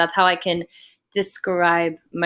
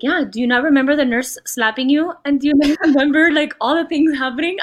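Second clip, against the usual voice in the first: higher, animated imitation of a mother; incredulous mom; 6 semitones higher, 4 dB louder than usual. Mom asking daughter if she remembers the birth, incredulous. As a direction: act out mom's disbelieving questions. Mom insisting on what happened.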